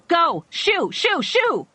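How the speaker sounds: high, loud repeated shouts; yelling. Acted. Doctor running into the coyote fight, high loud yells. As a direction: bark them away one by one.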